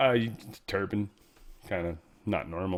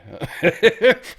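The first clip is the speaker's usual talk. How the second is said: laugh; 11 semitones higher, 10 dB louder than usual. Real, not acted. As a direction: burst of high loud laughter. Laughs at own bit.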